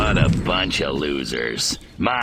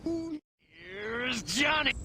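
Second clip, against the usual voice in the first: high, slow, menacing drawl; creepy singsong quote; movie quote; 9 dB quieter, much slower than usual. Acted. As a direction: deliver it like the horror movie line. Quoting The Shining line.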